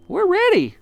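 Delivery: very high, loud little chorus; squeaky eager answer; monkeys answering. A narrator's reading. The monkeys reply to Mugglewump, very high and eager. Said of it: "said the monkeys".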